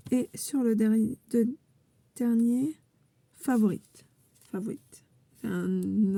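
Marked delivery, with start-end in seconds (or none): 4.5-5.0 English accent. Shown as a demonstration. Joking about the card's English word, in an English accent. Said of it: "un accent anglais, euh, mémorable".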